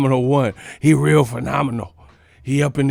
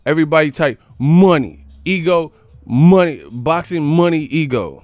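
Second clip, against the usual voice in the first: money hit louder each time; emphasis on money; 3 semitones higher, 6 dB louder than usual. Real, not acted. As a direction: punch every money. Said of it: "we gonna put some emphasis on it".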